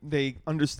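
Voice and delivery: usual speaking voice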